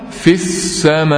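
"في السماء" joined as fis-samaaa with drawn-out ending; linked reading, ya dropped. Shown as a demonstration. Teacher showing how we read it, "في السماء" linked as fis-samaaa. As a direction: slowly run short fi into the doubled s of "السماء". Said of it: "أهو، فنقرأها".

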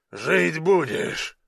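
slow, drawn-out verdict in a thug's voice; big brute offering amused reassurance; smirking giant. A narrator's reading. Giant reassures the revived youth, smirking. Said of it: "усмехнулся громила".

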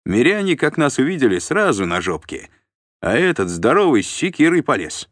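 animated, high-pitched soldier's report; lively boasting warrior. Acted. Warrior reporting a scuffle to his chief, animated boasting. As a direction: tell it loud and lively, like a boastful veteran. Десятник докладывает батьке.

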